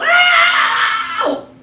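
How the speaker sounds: very high, loud yelp of pain; mother's piercing hurt cry; yelled. A narrator's reading. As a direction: shriek a long ow. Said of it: "And she yelled".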